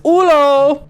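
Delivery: high, loud, drawn-out greeting; movie character's greeting. Acted. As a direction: imitate a film character's sing-out hello. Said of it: "And he says".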